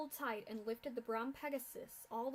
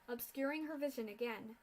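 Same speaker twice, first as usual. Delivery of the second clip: usual speaking voice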